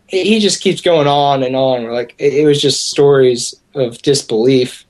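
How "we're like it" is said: ordinary delivery, nothing marked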